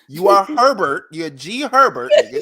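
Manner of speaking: loud, laughing mockery; mocking roast. Real, not acted. Roasting [name] about his name, loud and laughing. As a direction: rub his real name in his face.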